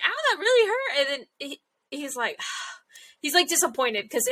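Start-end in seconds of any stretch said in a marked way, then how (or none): 0.1-1.0 high, whiny "Ow"; mock pained Winry. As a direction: complain like you got dropped. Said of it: "And she's like".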